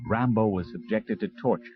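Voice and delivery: ordinary delivery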